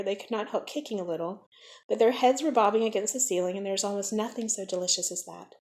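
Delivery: ordinary delivery, nothing marked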